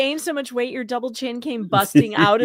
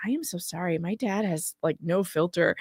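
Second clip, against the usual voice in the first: softer, hushed apology; private apology; 4 semitones lower, 6 dB quieter than usual. Retold or imitated. Apologising privately to her friend, softly. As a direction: lower the voice for a quiet aside. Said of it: "I said to him privately".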